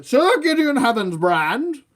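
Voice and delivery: loud, high, drawn-out proclamation; put-on heroic voice. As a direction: proclaim the knight's name grandly, slow and high. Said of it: "still doing this voice".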